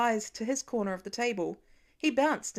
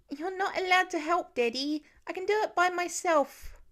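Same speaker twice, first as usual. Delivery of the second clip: put-on higher child's declaration; bossy little boy; 8 semitones higher than usual. A narrator's reading. Little boy telling his daddy not to help, high and bossy. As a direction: announce it like a proud small kid.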